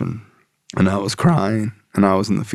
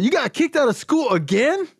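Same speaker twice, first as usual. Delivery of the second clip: much higher put-on voice of a blunt sponsor; tough sponsor voice. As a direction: bark out the tough-love lecture. Sponsor voice, shocked.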